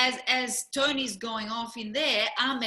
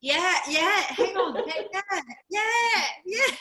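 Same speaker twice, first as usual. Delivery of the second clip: rising, louder string of yeahs; excited yeahs; 8 semitones higher, 4 dB louder than usual. Retold or imitated. Worn out at the event, still cheering yeah. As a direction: build the yeahs higher and higher. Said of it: "at one point I'm like".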